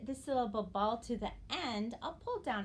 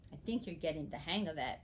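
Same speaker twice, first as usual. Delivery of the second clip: ordinary delivery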